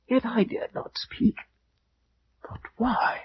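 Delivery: breathless, fearful whisper, high and soft; frightened whisper. Acted. Roderick confessing his dread to his friend, whispered. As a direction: hush it as if the dead might hear. Roderick fears to speak aloud.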